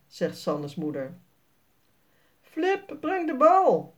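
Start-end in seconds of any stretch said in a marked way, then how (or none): 2.4-3.9 higher voice calling a dog; owner calling his dog. A narrator's reading. The owner tells Flip to bring the ball, higher. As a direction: call the dog to fetch. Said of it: "zegt de meneer".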